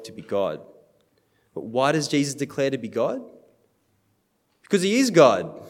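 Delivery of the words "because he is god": higher and louder, a firm declaration; emphatic proclamation. Real, not acted. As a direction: proclaim it with full conviction. Preacher's emphatic answer.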